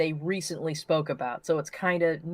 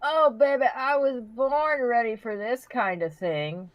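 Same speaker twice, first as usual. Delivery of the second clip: higher, slow, cocky bravado; hammed-up eagerness; 7 semitones higher, slower than usual. Cohost boasting to the host she's born ready, hammed-up and slow. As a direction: bring it on, playfully overconfident.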